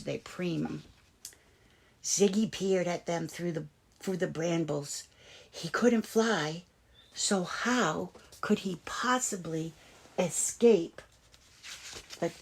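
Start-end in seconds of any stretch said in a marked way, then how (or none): none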